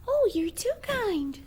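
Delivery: put-on high, slow, flattered little girl; sweet little girl.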